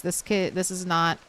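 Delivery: normal talking voice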